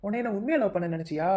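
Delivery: ordinary talk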